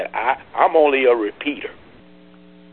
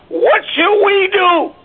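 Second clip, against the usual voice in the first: rousing call; 5 dB louder, slower than usual. Real, not acted. Preacher calling to congregation.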